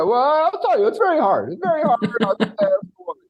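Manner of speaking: high mock voice of a man lecturing on women; mocking male expert. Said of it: "dudes are on women's podcasts".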